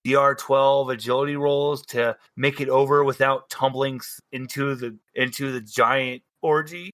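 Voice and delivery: ordinary talk, nothing marked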